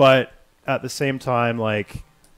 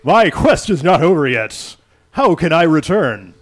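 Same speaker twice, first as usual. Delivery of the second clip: loud, slow, higher heroic plea; player character voice; 5 semitones higher, 10 dB louder than usual. Acted. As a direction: cry out like a determined hero. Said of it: "I'll change it up for the player character".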